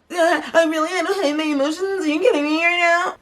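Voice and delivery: very high, whiny mimicry; mock whining. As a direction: imitate her emotional complaint, hammed-up. Mocks contestant airing her emotions.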